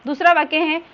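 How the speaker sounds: normal talking voice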